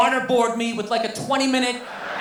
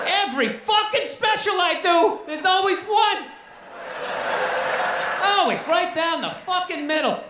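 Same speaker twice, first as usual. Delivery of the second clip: exasperated rant; 7 semitones higher than usual. Real, not acted. Ranting at heckler.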